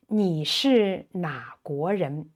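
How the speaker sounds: 你是哪国人 spaced out syllable by syllable; careful model. Shown as a demonstration. Teacher modelling which country question, carefully spaced.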